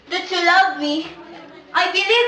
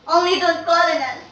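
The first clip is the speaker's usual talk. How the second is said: ordinary talk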